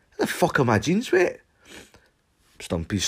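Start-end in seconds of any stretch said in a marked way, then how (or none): 0.1-1.4 baffled outburst. Retold or imitated. Confused outburst about wet jeans.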